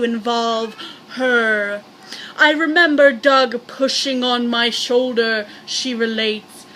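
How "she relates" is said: plain reading voice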